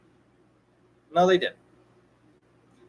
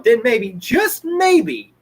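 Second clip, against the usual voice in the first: slow emphasis; 4 semitones higher, much slower than usual. Real, not acted. Slowed for emphasis.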